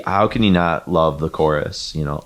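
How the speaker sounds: normal talking voice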